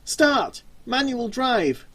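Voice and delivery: panicked yell at the van; yelled command. Acted. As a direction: bark the order at the van. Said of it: "he removed his mask and yelled".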